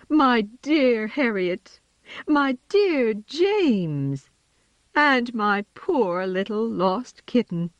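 slow tender joyful purr. A narrator's reading. Mother cat greets her children, slow joyful purr. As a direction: welcome the lost children home tenderly. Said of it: "purring with joy".

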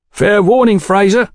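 high, loud warning; warning tough. A narrator's reading. Swarthy man threatening Fraser as he is held, warning. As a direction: warn him off, pitched well up.

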